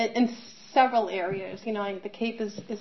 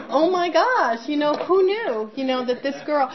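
higher and louder, amazed; 6 semitones higher, 5 dB louder than usual. Real, not acted. Mother marvelling at the coincidence, higher and louder. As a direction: marvel out loud at it.

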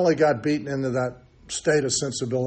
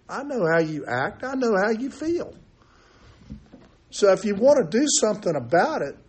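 higher, pleading counselor voice, rising up at the ends; earnest counselor; 4 semitones higher than usual. Retold or imitated. Old intake counselor urges a drinker to stay, higher and pleading. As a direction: talk him round like an old-timer who has been there. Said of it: "He goes".

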